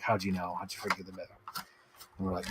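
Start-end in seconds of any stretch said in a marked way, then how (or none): 0.0-1.3 much softer and lower, caught out; a hint of a sheepish confession; sheepish fan. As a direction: mumble it like a busted fan. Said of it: "he'll be like".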